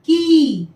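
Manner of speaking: की with a stretched long ii vowel; drawn-out long ii. Shown as a demonstration. Teacher modelling की with a long stretched ii. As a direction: pull the vowel of की long for children. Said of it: "क का उच्चारण क्या हो जाएगा".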